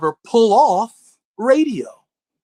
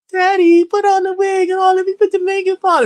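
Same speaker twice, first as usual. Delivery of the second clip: put-on high, louder little girl voice; pleading daughter; 10 semitones higher, 4 dB louder than usual. Imagined daughter begging Daddy for a tea party, high-pitched. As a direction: beg Daddy sweetly.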